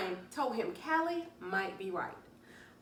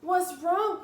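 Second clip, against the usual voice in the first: alarmed question; 9 semitones higher, 7 dB louder than usual. Acted. Callie alarmed at door.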